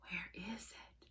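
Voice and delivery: hushed, barely voiced muttering; searching whisper. Teacher looks for her toy cow, searching whisper. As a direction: whisper as you hunt around.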